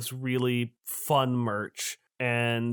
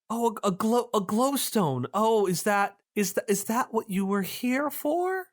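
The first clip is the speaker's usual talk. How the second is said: higher, sing-song villain charm; sweet higher man's voice; 7 semitones higher than usual. Acted. Pale man feigns delight to the player, pitched up and sugary. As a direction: fake politeness, pitched up and sugary.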